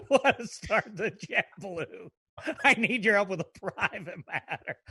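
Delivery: laughing through the words; real helpless chuckle while quoting it back. Real, not acted. Co-host cracking up at the start of the letter, laughing.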